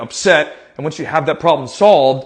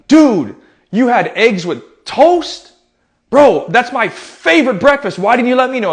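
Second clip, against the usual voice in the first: high, loud, drawn-out disbelief; hammed-up astonishment to cheer someone up; joking mock amazement; 7 semitones higher, 4 dB louder, slower than usual. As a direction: act hugely impressed, playfully. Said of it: "I'll make a joke".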